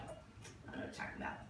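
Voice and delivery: hushed, quick brush-off; whispered denial. Retold or imitated. To dad's wife, dismissing her dream in a quick whisper. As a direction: whisper it like shrugging the dream away.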